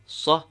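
Sa cut short, ah not held; short letter sound. Shown as a demonstration. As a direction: give Sa one brief back ah. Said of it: "initial sound of the letter".

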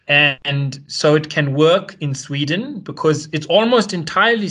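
slow, lifted mock Swedish accent, hammed up; deliberately bad Swedish accent, drawn out and sing-song; terrible Swedish accent. Imitating a Swedish economist on RT in a terrible Swedish accent. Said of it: "I'm gonna do a terrible accent".

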